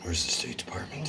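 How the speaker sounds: breathy whispered question. Real, not acted. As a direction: whisper it to yourself. Whispers to himself.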